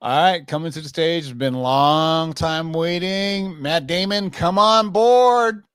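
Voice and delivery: emcee calling the guest; announcer voice. Pretend. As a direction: build to a loud drawn-out call.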